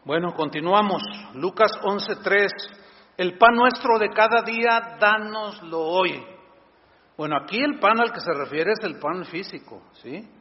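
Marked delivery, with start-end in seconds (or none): 3.2-6.2 prayer recitation. Acted.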